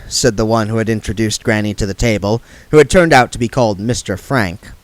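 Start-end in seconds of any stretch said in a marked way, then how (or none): none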